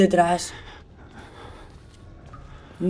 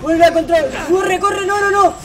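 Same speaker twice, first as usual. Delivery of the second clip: high panicked pleading; being chased; 8 semitones higher than usual. Real, not acted. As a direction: scream and beg while fleeing. Being chased, nearly dying.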